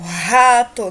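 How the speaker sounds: "rato" with a loud, strong initial R. Shown as a demonstration. Teacher showing the word-initial R in "rato", forceful demonstration. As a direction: push the R at the start of "rato". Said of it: "um R mais forte".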